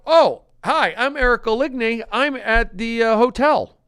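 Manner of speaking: loud, high skit greeting; exuberant greeting. Acted. Skit character introduces himself at the hotel, exuberant greeting. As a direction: announce yourself in a high, loud voice.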